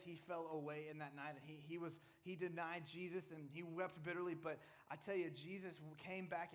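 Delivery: ordinary talk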